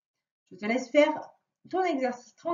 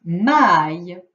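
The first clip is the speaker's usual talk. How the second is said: loud dictation; 5 semitones lower, 9 dB louder, much slower than usual. Shown as a demonstration. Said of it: "C'est parti".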